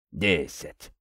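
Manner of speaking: low, soft counter-offer; name the price quietly, almost by reflex; automatic haggling. A narrator's reading. Prince haggling with the captain by habit, low and understated. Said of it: "машинально стал торговаться князь".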